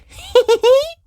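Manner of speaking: a very high, bright little sheep giggle. A narrator's reading. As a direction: giggle out loud like a small child.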